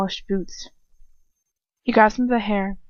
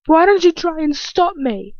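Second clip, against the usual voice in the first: high, loud goading challenge; acted jeering dare, pitched well up; loud taunt; 9 semitones higher, 6 dB louder than usual. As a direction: taunt a helpless victim. Attacker taunts the choking victim.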